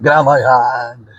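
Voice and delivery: mock-dramatic drawn-out demand; imitated skit partner. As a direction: stretch out a retold order, loudly. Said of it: "And he's like".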